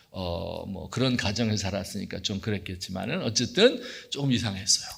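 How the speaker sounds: ordinary delivery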